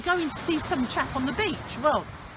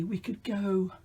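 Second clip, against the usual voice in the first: whispering; 4 semitones lower, 4 dB quieter, slower than usual. Acted. Thinking aloud to children.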